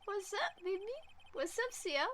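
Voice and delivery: high, put-on mock flirty greeting; joking flirtation. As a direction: greet them in a silly high voice.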